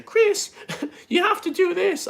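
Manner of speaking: high-pitched, drawn-out mock yell; slow, squawked send-up of a yelling boss; mock boss shout. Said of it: "would shout at me saying".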